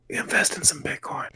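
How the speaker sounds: hushed secret whisper; soft conspiratorial whisper; whispered tip. Retold or imitated. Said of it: "and say".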